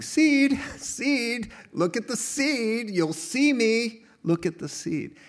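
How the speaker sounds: high, calling out "seed"; high calling God voice. Pretend. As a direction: call it like God pointing at the seed.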